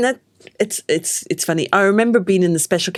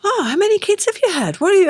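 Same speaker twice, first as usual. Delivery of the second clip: astonished nurses; 10 semitones higher than usual. Retold or imitated. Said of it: "they were just going".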